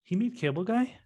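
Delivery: high surprised question. Real, not acted. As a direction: ask it in disbelief. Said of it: "I did not know that".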